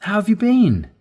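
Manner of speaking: "How have you been?" pitched high and bright; eager greeting; excited. Shown as a demonstration. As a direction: sound really interested. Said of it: "Do you notice the difference".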